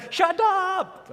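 high-pitched yell out the door; drawn-out, high acted shout; mock yell. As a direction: yell at the barking dogs in mock exasperation. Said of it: "going out to the door and say".

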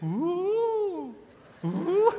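long high howl; high, sustained "ooh" like a dog; imitated dog howl. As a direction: howl along with the passing van. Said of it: "dog will do this".

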